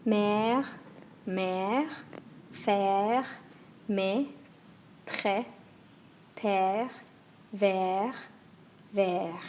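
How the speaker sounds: «Mère», «faire», «très» each with a long, open French è; French è words. Shown as a demonstration. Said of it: "it's longer and more open".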